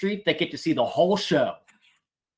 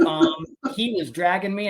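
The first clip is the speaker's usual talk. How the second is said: ordinary talk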